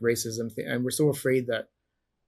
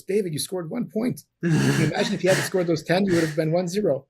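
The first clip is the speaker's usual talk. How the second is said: higher, louder mock disbelief; teasing friend; 6 semitones higher, 5 dB louder than usual. Friend Faisal teasing him after the loss, higher and louder. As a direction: rib him with a cheeky jab. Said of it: "he goes".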